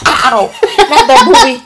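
loud, high, laughing protest; laughing yelp. Real, not acted. Yelps, laughing.

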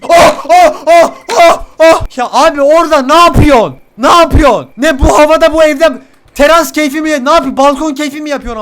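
loud, high indignant yelling; outraged shouting. Real, not acted. Gamer yells at the enemy on the balcony, outraged. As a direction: yell at them in disbelief.

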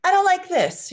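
high, loud forceful I don't like this; strong statement. Shown as a demonstration. Parent showing child how to voice anger, loud and strong. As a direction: belt it out strongly. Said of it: "say something really strong".

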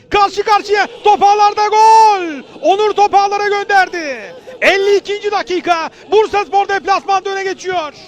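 loud excited goal call; screaming football commentator. Real, not acted. Goal scored, commentator erupts. (gol anında bağıran spiker)

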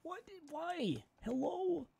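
very high, strained squeak; squeaky why. Real, not acted. Streamer squeaking at the broken program in high disbelief. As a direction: squeak it in comic despair.

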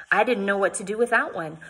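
ordinary delivery

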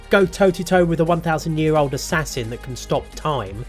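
usual speaking voice

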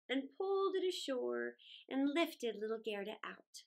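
ordinary delivery

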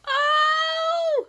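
high, loud cry of joy breaking into laughter; overjoyed shriek. Real, not acted. Opening her cousin's gift, shrieking with joy. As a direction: scream with delight at the gift.

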